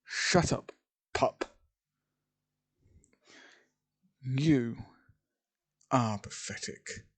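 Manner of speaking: mildly angry retort, spaced out; snapping back. A narrator's reading. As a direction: snap back with measured contempt, in pieces. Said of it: "snaps back saying".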